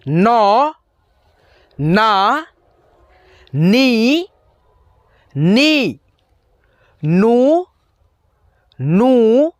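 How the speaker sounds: each syllable of नौ ना नी नू said slowly and separately with a pause after it; syllable drill. Shown as a demonstration. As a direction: recite the न barakhadi one syllable at a time, clearly. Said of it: "सारे बारह खड़ी को अब आपको यहाँ पे उच्चारण करना है". (हर अक्षर अलग और साफ़ बोलते हुए बारहखड़ी)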